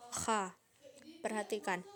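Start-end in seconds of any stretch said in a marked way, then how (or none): 0.1-0.6 "Kha" with a rasp at the back of the throat; snore-like kha. Shown as a demonstration. Teacher shows the letter kho, "Kha" rasped like snoring. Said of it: "seperti suara orang yang sedang mendengkur".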